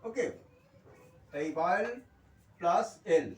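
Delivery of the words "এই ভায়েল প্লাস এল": usual speaking voice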